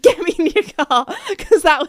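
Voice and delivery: says it through a laugh. Real, not acted. Repeated plea, laughing.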